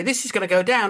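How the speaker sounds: high, earnest caution; mock warning. Investor predicting a fall, mock earnest. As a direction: warn it lightly, tongue in cheek. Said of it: "Or if you say".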